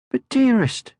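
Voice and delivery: high, gentle motherly voice; kindly mother troll; Moomin Mama. Acted. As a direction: soothe him like a calm mother.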